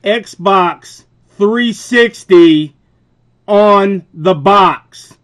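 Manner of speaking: reading the box aloud word by word to a clerk who didn't check, slowly and loudly; slow pointed emphasis. Shown as a demonstration. Spell it out slowly, hammering Xbox three sixty. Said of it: "let me show you here".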